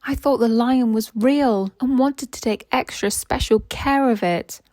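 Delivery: a hint of a small, sheepish engine saying sorry; sorry Thomas. Thomas apologising to the fat controller, lightly voiced sheepish engine.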